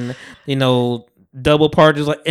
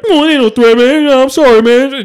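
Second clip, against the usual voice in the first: pleading backpedal; 9 semitones higher, 6 dB louder than usual. Retold or imitated. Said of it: "He's like".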